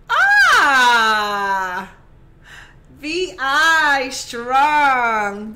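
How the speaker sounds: shouted chant, drawn out slowly; loud VI strong cheer. Real, not acted. Proud islander cheering to the camera, slow loud shout. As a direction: belt out island pride at full volume.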